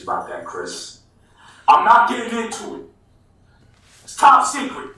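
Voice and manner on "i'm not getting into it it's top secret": very high-pitched, louder mock protest; playfully secretive refusal. Guest refuses to disclose tour plans to host, playful high squeal.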